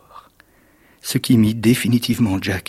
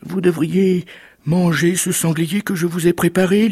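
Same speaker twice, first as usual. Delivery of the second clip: high-pitched anxious wife voice; trembling wife; 8 semitones higher, 3 dB louder than usual. Acted. Giant's wife offers the boar to her husband, anxious high voice. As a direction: placate him in a raised pitch. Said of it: "en tremblant".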